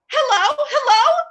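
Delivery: a nervous invalid on a dead phone, frantic; frantic hellos. Acted. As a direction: call into the dead line in rising panic.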